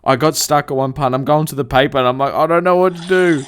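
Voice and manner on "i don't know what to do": loud, raised cry of confusion; mock helpless wail. New worker stuck on the job with his notes, hammed-up helpless wail. Retold panic at being stuck.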